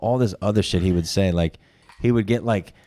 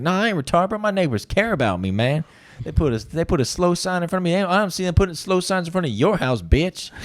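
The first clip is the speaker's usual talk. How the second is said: pitched-up cocky street talk; high cocky voice; 8 semitones higher than usual. Retold or imitated. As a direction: play him cocky and proud, voice up high. Voicing Jonathan defending himself.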